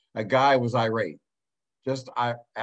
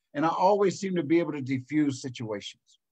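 ordinary delivery

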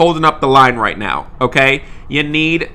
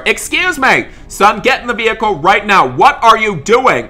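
sharp higher shout across the lot; 6 semitones higher than usual. Pretend. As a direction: call out to stop the boy. Dad shouting at son.

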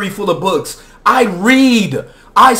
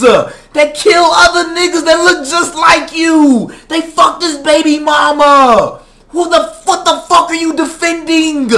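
high, raging demand; angry yelling; 5 semitones higher than usual. Real, not acted. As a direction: yell it at him in disbelief. Angry rant at gangster defenders.

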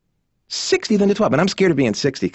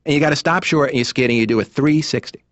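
usual speaking voice

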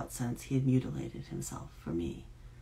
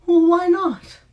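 much higher, much louder, a cracked desperate laugh; desperate shrill laugh; 17 dB louder, slower than usual. A narrator's reading. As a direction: laugh it out high and shrill, near breaking. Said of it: "She laughed, desperate and shrill".

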